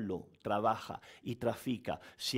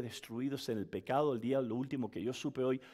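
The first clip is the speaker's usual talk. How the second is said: normal talking voice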